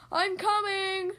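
slow, high call to a friend; storybook yell. A narrator's reading. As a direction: call it out long, as if far away. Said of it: "yelled Hippo".

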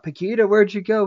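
mild put-on call; mock calling out. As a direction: call after a missing date, half-heartedly. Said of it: "call out".

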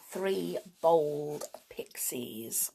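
slow whispered "Three Bold Pixies"; whispered title. Shown as a demonstration. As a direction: breathe "Three Bold Pixies" slowly, stress on "Bold". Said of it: "the first story is actually called".

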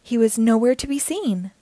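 usual speaking voice

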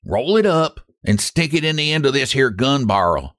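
lightly voiced rough robber; a hint of a gruff gunman; robber's order. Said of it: "came the order".

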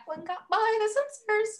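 high squeaky mock outrage; squeaky disbelief. Real, not acted. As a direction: voice jumps up in disbelief. High-pitched joking aside.

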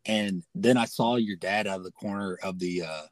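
normal talking voice

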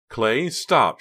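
high, loud alarmed shout. A narrator's reading. Pam shouts at Clay driving wildly, alarmed. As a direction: shout at the driver to stop. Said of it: "she shouted".

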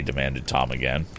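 usual speaking voice

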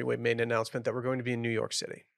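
ordinary talk

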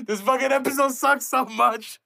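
high, slow words through helpless laughter; giggling fit over the episode; laughing. Real, not acted. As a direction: crack up while complaining. Laughing at own bad episode.

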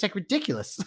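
ordinary delivery

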